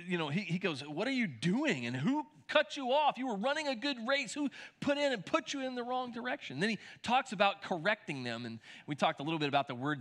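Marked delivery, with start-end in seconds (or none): 0.8-6.6 mock-angry questioning, climbing higher; sort of angry. Paul challenging the church about who cut them off, sort of angry. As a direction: demand answers as an exasperated apostle. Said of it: "It's sort of angry".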